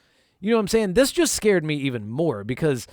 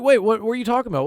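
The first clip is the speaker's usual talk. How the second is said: startled confusion; 9 semitones higher, 3 dB louder, much faster than usual. Retold or imitated. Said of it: "I'm like".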